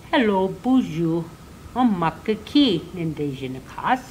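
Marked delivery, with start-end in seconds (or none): none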